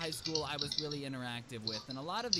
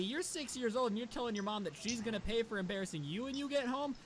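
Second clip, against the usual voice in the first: usual speaking voice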